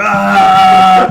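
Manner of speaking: loud comic charging yell; comic battle yell. Acted. GM acts the failed charge.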